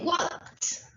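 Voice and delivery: "walked" with a crisp final t; ED ending said as t. Shown as a demonstration. Teacher modelling the ending of "walked" for Spanish-speaking students, crisp t. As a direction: say "walked" as wokt, no extra syllable. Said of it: "Añadimos E D".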